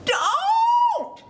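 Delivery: very high, stretched squeal; shrieked storybook protest. A narrator's reading. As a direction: squeal out like a panicked goat. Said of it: "squealed the middle billy goat gruff".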